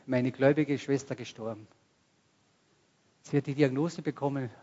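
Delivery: ordinary talk, nothing marked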